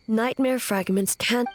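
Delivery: normal talking voice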